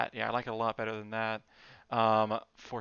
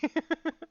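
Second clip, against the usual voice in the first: short laugh; 4 dB louder than usual. Real, not acted. Laughs at the title.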